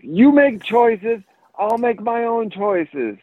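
high, slow imitation of a kid; defiant young child. Four-year-old standing up to his dad, imitated defiant child. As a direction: draw it out in a put-on kid voice.

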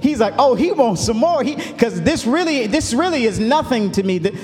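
rising, building sermon cadence with long held words; fiery preaching. Acted. Pastor preaching to his church, climbing, full-force sermon delivery. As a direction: preach it out to the congregation, pitch climbing.

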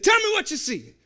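a commanding demand in a bad Jamaican accent. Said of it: "No, it's terrible".

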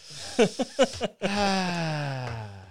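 long, drawn-out falling sigh. Real, not acted. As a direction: let out a long rueful sigh. Rueful after catching own slip.

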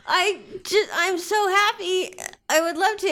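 tearfully happy and grateful; happy tears. Acted. [name] voiced happy tears.

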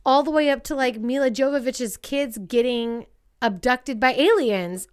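ordinary delivery

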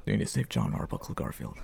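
whispered, sinking low at the end; creepy whisper. Retold or imitated. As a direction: hush it like a horror villain. Imitating Jigsaw voice.

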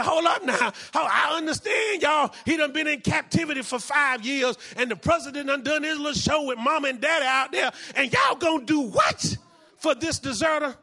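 acted outrage, preached at full force; indignant protest. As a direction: object forcefully, building to an incredulous question. Acts the protesting elder brothers.

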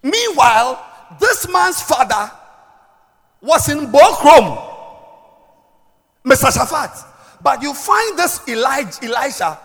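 loud, emphatic preacher delivery; forceful preaching. Acted. Preacher teaching on Elisha's two fathers, forceful preaching. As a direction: drive each phrase home to the congregation.

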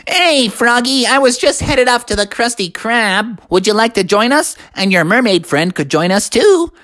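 high cartoon sponge voice; friendly SpongeBob. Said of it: "SpongeBob walked up to him and said".

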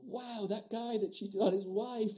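high, slow mock wonder; drawn-out, high-pitched marvelling; imagined amazement. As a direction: imitate an awestruck, delighted churchgoer. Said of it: "one would hope that people would go".

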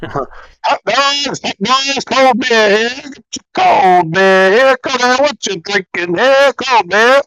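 high, drawn-out ballpark hawker cry; stadium vendor call. Pretend. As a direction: sell hot dogs and beer up the bleachers. Mock stadium vendor hawking beer.

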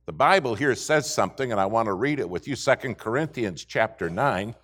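normal talking voice

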